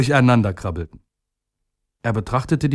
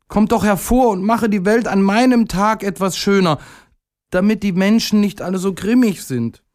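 much higher pleading voice; little day pleading; 10 semitones higher than usual. A narrator's reading. Little day begging the sun to shine, high pleading voice.

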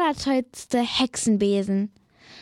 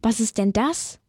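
usual speaking voice

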